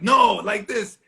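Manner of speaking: sharp loud correction, father's voice; mean father yelling from the next seat; mock angry shout. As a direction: bark the correction like a tough dad. Father angrily correcting him in van.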